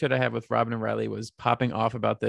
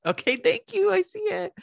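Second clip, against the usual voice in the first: squeaky mock thanks. Real, not acted. Reacting to tattoo.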